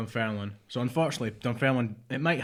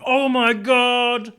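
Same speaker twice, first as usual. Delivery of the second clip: excited shriek; 13 semitones higher, 13 dB louder, much slower than usual. Retold or imitated. Retold reaction to Nando's delivering.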